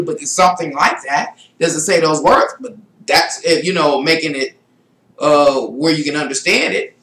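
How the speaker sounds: ordinary talk, nothing marked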